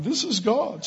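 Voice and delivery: very high awed exclamation; mock awestruck. Young man joining in at the checkout, mock awestruck. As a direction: imitate amazement with a leap in pitch.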